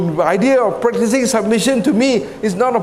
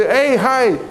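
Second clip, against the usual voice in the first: higher-pitched, chummy hello; casual greeting; 7 semitones higher than usual. Retold or imitated. Recruit greets senior officer like a church friend, casual greeting. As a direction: call out a friendly hello to a pal.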